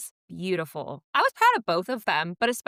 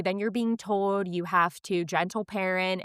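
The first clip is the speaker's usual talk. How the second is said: ordinary talk, nothing marked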